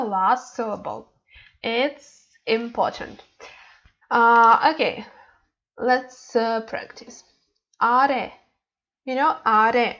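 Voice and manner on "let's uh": ordinary delivery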